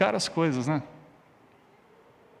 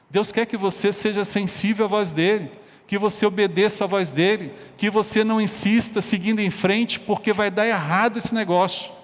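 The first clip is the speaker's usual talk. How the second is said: raised, higher-pitched urgent exhortation; forceful preaching; 4 semitones higher, 3 dB louder than usual. Real, not acted. Preacher urging listeners to obey God, forceful. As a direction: press the warning home from the pulpit.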